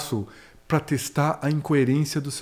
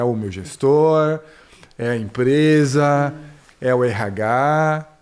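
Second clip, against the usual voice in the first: louder and slow, one culprit at a time; slow emphatic list; 5 dB louder, much slower than usual. Acted. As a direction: spell out each culprit slowly and loudly. Listing the blamed parties. (lista lenta e enfática dos culpados)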